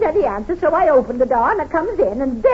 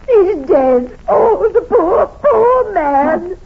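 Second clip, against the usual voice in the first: crying; 4 semitones higher, 6 dB louder, much slower than usual. Acted. Landlady wails over the body.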